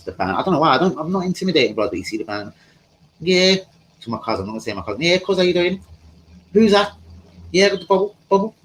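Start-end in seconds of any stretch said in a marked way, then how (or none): 3.2-3.6 high-pitched Manchester dealer gabble; put-on Manchester patter, pitched right up; mock Manchester accent. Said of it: "And his Manchester accent". 5.0-8.5 high-pitched Manchester dealer greeting; put-on Manchester voice, pitched up; mock Manchester accent. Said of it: "And his Manchester accent".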